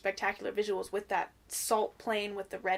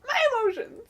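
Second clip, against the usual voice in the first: mock overwhelmed; 13 semitones higher, 3 dB louder than usual. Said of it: "and you're like".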